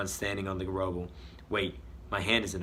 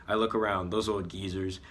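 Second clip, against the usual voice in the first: plain reading voice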